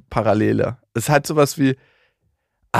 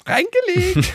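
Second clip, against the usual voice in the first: squeaky high-pitched prank voice; gleeful high gotcha; much slower than usual. Retold or imitated. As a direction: crow it like a prankster. Friend imagined returning, gleeful prank. (schadenfroh hohe Stimme)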